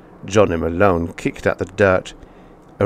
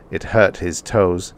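ordinary delivery

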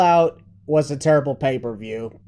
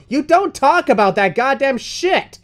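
outraged; 10 semitones higher, 5 dB louder, slower than usual. Acted. Outraged at Punk's comments.

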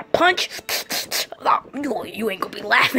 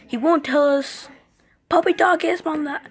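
ordinary talk, nothing marked

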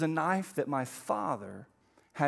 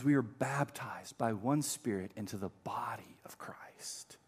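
1 whisper; 6 semitones lower, 6 dB quieter, slower than usual. Real, not acted.

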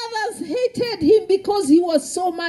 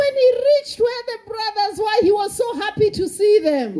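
fast, higher, eager telling; excited; 8 semitones higher, faster than usual. A narrator's reading. Preacher tells the boy's happy arrival, fast and high. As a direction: rush it with a boy's joy.

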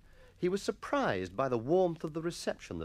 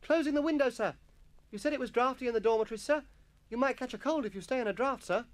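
put-on high-pitched boy explaining himself; solicitous schoolboy; 12 semitones higher than usual. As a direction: answer the master in a piping, helpful voice. Jennings answers the master.

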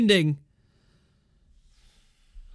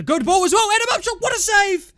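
high, loud cry at the save; real fan yelling over a near miss; excited shout; 12 semitones higher, 7 dB louder than usual. Real, not acted. Live reaction to chance and save.

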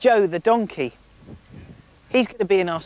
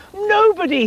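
high, louder joyful nobody; happy king; 13 semitones higher, 5 dB louder than usual. Acted. King happily telling the queen, high joyful exclamation. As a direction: burst out delighted. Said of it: "kissed her tenderly".

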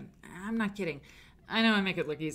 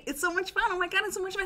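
gushing excitement; 11 semitones higher, 3 dB louder, faster than usual. Real, not acted. Gushing about show.

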